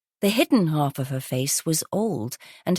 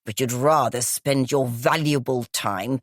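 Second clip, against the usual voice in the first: gravelly, smoky voice; 5 semitones lower than usual. Acted. Groggy guest exclaiming to police in a low smoky rasp. Croaky woman character voice.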